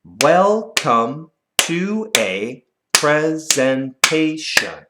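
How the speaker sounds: very slow, syllable by syllable; clapped syllables. Shown as a demonstration. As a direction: clap out each syllable slowly. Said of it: "Let's clap it".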